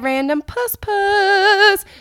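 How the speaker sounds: slow, high, loud sing-out; loud drawn-out farewell squeal; playful sign-off. Real, not acted. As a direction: stretch it out high and silly.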